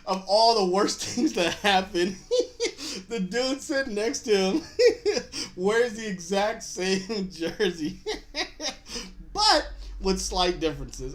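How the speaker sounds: words broken by real laughter; can't get through it for laughing. Real, not acted. Telling the jersey twist, laughing hard. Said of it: "laughing through the story".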